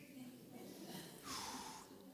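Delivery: long, breath-only sigh; relieved sigh. Real, not acted. Relief after the long word.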